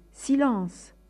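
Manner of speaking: loud, high command for silence; shouted order. Acted. Man with the silver cane shouting the crowd down, loud. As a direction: cut the crowd off with one yell. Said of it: "avait beau crier".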